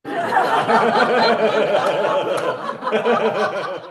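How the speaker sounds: a high, wheezing burst of laughter; real helpless laugh. Real, not acted. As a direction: break up laughing at the joke. Laughing at his own joke.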